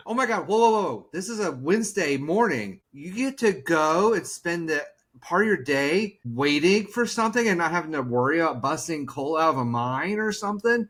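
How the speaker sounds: hammed-up awe at a car repair; mock amazement. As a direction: gush like it's the best news ever. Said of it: "started catching on what I was doing".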